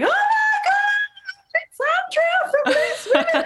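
high laughing mock shriek of recognition; imitating screaming fans. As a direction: squeal it like a hen party spotting a celebrity. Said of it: "started screaming and going".

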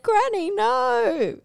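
high, slow scolding of granny; mock scolding. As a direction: stretch it out, high and reproachful. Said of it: "I basically said".

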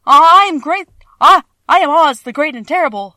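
loud, high, frightened stammer; trembling voice. A narrator's reading. Cornered little man announcing himself to his attackers, trembling. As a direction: tremble through a grand announcement. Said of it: "in a trembling voice".

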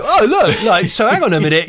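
hammed-up chirpy TV host; excitable presenter. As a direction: burst in to take charge. Presenter bursts into soap scene.

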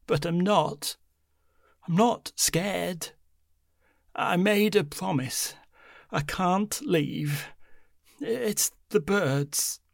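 put-on slow, insistent old woman; deliberate, defiant old grandmother; stubborn old woman. A narrator's reading.

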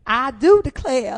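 slow, high, loud Southern belle accent. Shown as a demonstration. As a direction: draw it out in a hammed-up Southern accent. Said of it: "sort of accent".

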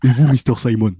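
teacher singling someone out, laughing; mocking teacher. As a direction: needle the student with a teasing question. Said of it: "pour me foutre de sa gueule, c'est, il a dit".

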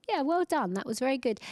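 bright, high praise from a proud mum; mum's proud praise. Retold or imitated. Mum praising her daughter with bright, high approval. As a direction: pat on the back with the voice.

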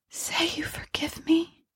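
very high, soft, whispery plea; hollow and faint, barely there; thin, monotonous ghost voice. Acted. Dead wife begs husband to forgive her, thin and monotonous. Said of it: "the thin, monotonous voice went on".

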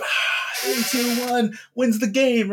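high, slow game-call voice; kid announcer. Retold or imitated. Kid in the backyard announcing the game winner, high and drawn out. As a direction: call the winning shot like a backyard commentator.

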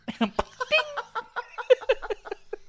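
high laugh. Real, not acted. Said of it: "laughter breaks the bit".

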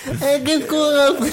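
high, loud, laughing teacher; hammed-up schoolteacher through giggles; mock teacher voice. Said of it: "T'es particulière, ta maîtresse".

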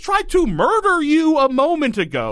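high, disbelieving outburst; incredulous. Real, not acted. Host reacting to the kid's murder plot, incredulous and high.

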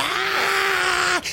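high shrieking scream; witch scream. Acted. Witch bitten by the dogs, high scream. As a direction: let out a wounded witch shriek. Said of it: "Y la vieja grita".

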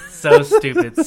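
high squealing laugh through words. Real, not acted. Laughing at her own story.

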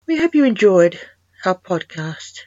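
loud, bright sign-off to the listeners; host thanking listeners in a big announcer voice; podcast outro. Acted.

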